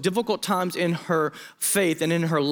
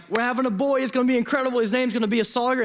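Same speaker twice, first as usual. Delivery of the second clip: excited announcement; 7 semitones higher, faster than usual. Retold or imitated. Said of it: "was like".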